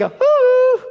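very high, loud teasing ooh; God calling to be found; playful hoot. Retold or imitated. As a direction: call out like someone hiding. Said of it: "God's saying".

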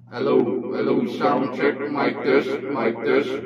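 low processed voice changer; deep pitch shifted. Shown as a demonstration. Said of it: "mababa yung, ah, ano niya, yung voice changer". (mababang boses mula sa voice changer)

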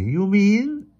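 tentative question in a high voice; hesitant. A narrator's reading. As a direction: start the question high, leaving it hanging. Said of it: "she began hesitantly".

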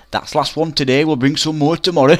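high, loud taunt breaking into a laugh; loud, high gleeful taunt with laughter. Retold or imitated. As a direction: crow it triumphantly. Kids' revenge line, laughing.

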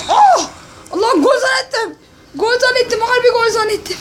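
high, laughing through the words; laughing disbelief. Real, not acted. Near goal, laughs.

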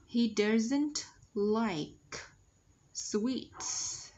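"sweets" ending on a clear, hissed s; slow model sentence, releasing the s in "sweets"; final S sounded. Shown as a demonstration. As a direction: say it word by word, with a distinct s closing "sweets". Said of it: "S ending sound".

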